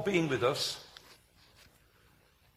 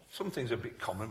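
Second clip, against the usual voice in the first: normal talking voice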